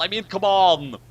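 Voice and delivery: ordinary talk, nothing marked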